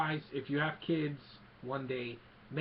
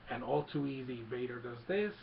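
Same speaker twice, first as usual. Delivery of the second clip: ordinary delivery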